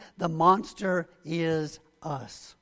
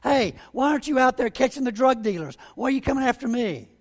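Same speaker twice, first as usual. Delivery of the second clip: higher, louder indignant complaint; an offender protesting his arrest, voice raised; mock outrage from the back of a squad car; 6 semitones higher, 4 dB louder than usual. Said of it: "and they'll say".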